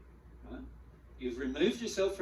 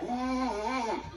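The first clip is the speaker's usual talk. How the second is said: mock outburst; 9 semitones higher, 3 dB louder than usual. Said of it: "it's just coming out of us like".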